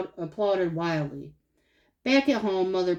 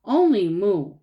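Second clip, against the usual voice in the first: grumbling, a bit louder; sulky and insistent; grumpy; 5 dB louder, slower than usual. A narrator's reading. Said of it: "in a grumpy voice".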